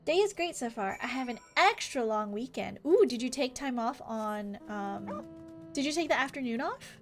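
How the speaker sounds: ordinary talk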